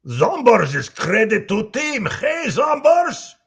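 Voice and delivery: high, loud Russian shout; Heavy's voice. Pretend. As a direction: call out proudly like a giant brute. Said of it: "could you read this in Heavy's voice".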